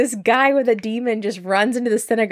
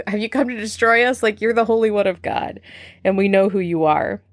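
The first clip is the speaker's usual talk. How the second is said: a lightly voiced demon, pitched up; 3 semitones higher than usual. Demon admitting to Jesus who he is, lightly voiced. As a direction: give the demon a strange, higher voice. Voicing the demon speaking truth.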